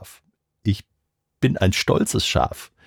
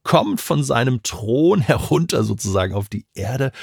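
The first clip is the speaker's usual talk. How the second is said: ordinary delivery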